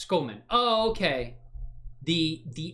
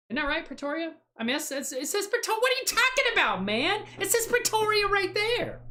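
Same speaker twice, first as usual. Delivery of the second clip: much higher, squeaky put-on voice, arguing back; mock squeaky voice. Streamer mocking a viewer about Pretoria in squeaky falsetto. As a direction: squeal it like a whiny know-it-all. Mocking chat over pronunciation.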